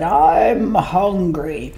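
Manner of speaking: slow, drawn-out whale voice; hungry whale. Acted. Whale announcing his hunger, slow and heavy. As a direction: stretch it out like a huge hungry whale. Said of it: "the whale stood up on his tail and said".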